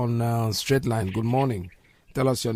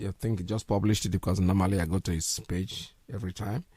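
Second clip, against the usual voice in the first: usual speaking voice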